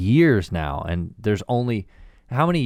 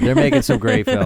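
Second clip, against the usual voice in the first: sarcastic; 6 dB louder than usual. Acted. Takes back criticism, mocking.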